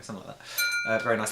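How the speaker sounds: usual speaking voice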